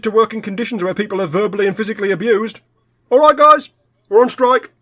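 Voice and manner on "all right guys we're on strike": loud, high strike call; rally the crew with a raised voice. Acted. Worker calling colleagues out on strike, rallying shout.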